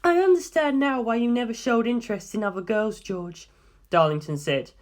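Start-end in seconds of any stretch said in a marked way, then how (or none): 0.0-3.5 put-on young woman, higher than the narration, steady and serious; Darlington's voice. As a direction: lay out the findings calmly, like a briefing. Darlington teasing George.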